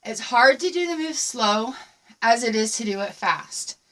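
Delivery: ordinary talk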